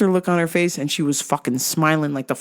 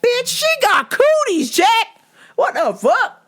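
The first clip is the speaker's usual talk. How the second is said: high, louder, squawking disbelief; comic outrage; 3 dB louder, slower than usual. Retold or imitated. Teller sees the hug, high comic outrage. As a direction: squawk it out, scandalized. Own outraged reaction retold.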